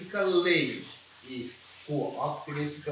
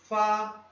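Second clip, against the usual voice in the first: "Fa" sustained high and level, louder; first tone; 5 semitones higher, 5 dB louder than usual. Shown as a demonstration. Teacher demonstrating first tone again for learners, "Fa" high and level. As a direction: keep "Fa" up on a steady high note. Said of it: "Primeiro tom".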